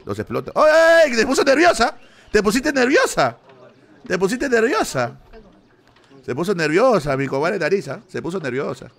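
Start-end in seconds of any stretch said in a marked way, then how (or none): 0.5-5.2 cackling at the dealer who got flustered; gleeful teasing. Real, not acted.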